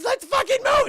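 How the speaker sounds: very high, loud frantic yell, acted; with real emotion. Imitating Liv desperate before the train hits, frantic scream. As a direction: scream it in hammed-up panic. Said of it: "putting some emotion into it".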